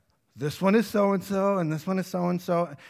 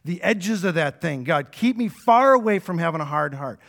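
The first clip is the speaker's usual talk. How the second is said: earnest loud prayer; 4 semitones higher, 7 dB louder than usual. Pretend. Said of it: "if we're saying".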